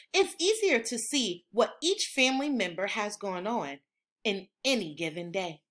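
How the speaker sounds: ordinary delivery, nothing marked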